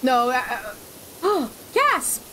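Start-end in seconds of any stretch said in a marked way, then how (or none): 1.2-2.2 high mock gasp; gasp while saying gasp. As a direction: gasp and say the word gasp. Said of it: "did you just say gasp while gasping".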